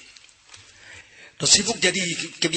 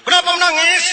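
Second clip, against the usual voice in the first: much higher, louder shouting uncle; mock shouted question; 7 dB louder than usual. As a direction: bellow at the crying child. Uncle yelling at crying kid.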